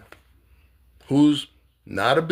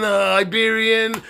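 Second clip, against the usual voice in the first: ordinary talk, nothing marked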